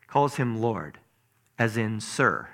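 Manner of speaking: ordinary talk, nothing marked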